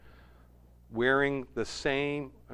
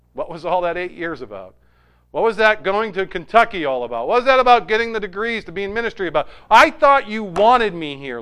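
rising, louder questioning of God; exasperated plea; 7 semitones higher, 10 dB louder than usual. Retold or imitated. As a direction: build from puzzled to pleading protest. Acted frustrated prayer questioning God.

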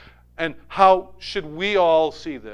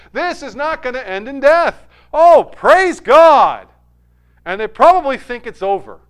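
full preaching voice; 6 semitones higher, 11 dB louder than usual. Acted. Preacher urging the congregation toward God's presence, preaching at full force. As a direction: raise the pitch and pour it out to the congregation. Preacher exclaiming over Jesus' words.

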